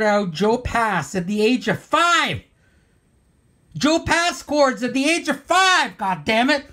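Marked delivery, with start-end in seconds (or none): 3.8-6.7 high-pitched incredulous yelp; worked-up and much higher, ending in a curse; exasperated outburst. Real, not acted. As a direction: cry out in envious outrage. Outburst over Rick's childhood talent.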